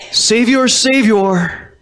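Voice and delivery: "Savior, Savior" repeated high, the first one highest; emphatic repetition. Shown as a demonstration. Preacher hammering the word home to the church, emphatic. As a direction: ring out "Savior" twice, stepping down. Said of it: "The repetition is there for emphasis".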